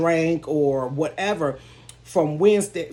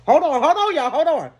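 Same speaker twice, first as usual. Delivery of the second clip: getting emotional; 10 semitones higher, 6 dB louder than usual. Real, not acted. Telling her story, suddenly overcome with emotion. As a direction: voice cracking high as tears come. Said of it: "I cannot believe I'm getting emotional".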